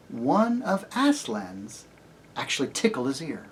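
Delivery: usual speaking voice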